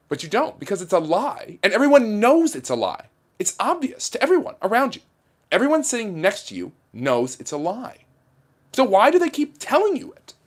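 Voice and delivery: high-pitched, incredulous pundit rant; Tucker Carlson impression. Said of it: "do my Tucker Carlson impression for you".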